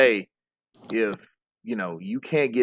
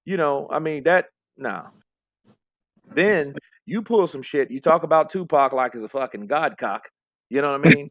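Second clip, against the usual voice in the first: normal talking voice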